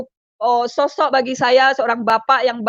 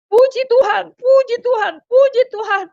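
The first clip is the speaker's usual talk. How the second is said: high-pitched cry of praise, a little louder; retold joyful shout; 9 semitones higher, 3 dB louder than usual. Husband cries out on agreeing to reunite, imitated high shout. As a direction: re-enact his repeated cry, voice lifted high. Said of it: "Langsung dia teriak".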